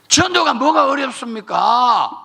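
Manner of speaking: loud, high, slow rallying cry; full-force preaching shout. Real, not acted. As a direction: hurl the question at the congregation.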